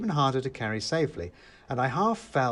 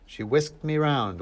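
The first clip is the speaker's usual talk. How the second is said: ordinary delivery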